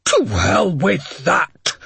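slow, furious outburst; angry shout. A narrator's reading. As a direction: shout it angrily. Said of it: "Harding shouted".

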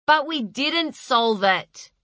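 a touch indignant; mildly offended. A narrator's reading. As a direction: push back, affronted. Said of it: "She was personally offended by this".

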